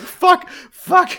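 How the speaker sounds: high, loud panicked cursing; hammed-up imitation of a crying hero; mock crying panic. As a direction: yelp each curse like you're running scared. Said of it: "running and crying and being like".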